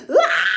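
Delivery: loud mock-terrified shriek; hammed-up murder-victim shriek. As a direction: scream like a horror-movie victim. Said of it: "like, shrieking".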